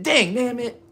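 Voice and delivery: hammed-up minced oath; mock clean swearing. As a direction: squeak the fake swear. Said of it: "That's how we gotta talk".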